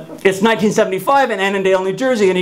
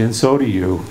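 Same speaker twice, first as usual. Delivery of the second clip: mournfully; 5 semitones lower than usual. Retold or imitated. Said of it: "He says it mournfully".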